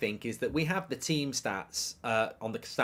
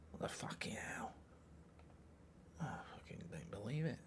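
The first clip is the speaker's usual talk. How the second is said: whispered swearing in disbelief; hushed, gutted whisper; stunned whisper; 20 dB quieter than usual. Real, not acted. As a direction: breathe it out, not quite believing. Disbelief as player misses bench.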